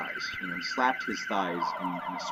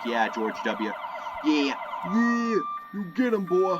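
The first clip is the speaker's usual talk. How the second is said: high, loud hollering for George; cheering crowd; 9 semitones higher, 3 dB louder, slower than usual. Retold or imitated. Americans cheering Washington on, hollering loudly. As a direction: whoop him on like a rowdy fan. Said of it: "they were like".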